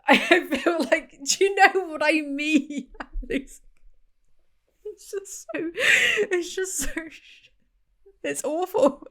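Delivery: mock sobbing over a bad book scene, laughing through it; mock crying. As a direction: pretend to be reduced to tears, half laughing. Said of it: "I'm reduced to tears".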